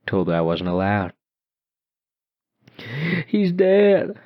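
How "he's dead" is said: put-on high, louder, eerie child voice; ghost girl taunting. A narrator's reading. Ghost girl telling narrator his father is dead, eerie and high. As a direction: deliver it like a cruel trick.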